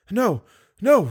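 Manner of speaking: high-pitched, hurried denial; anxious girl. Acted. Girl hides the cry from monster, anxious. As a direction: deny it in a high girl's voice.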